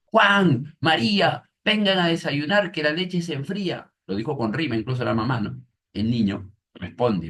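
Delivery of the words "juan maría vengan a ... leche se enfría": loud, high call to breakfast; teacher acting out the mother's loud call to the kids; raised voice. Shown as a demonstration. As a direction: raise the voice for the exclamation marks. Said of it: "el signo de admiración significa que levanto mi voz".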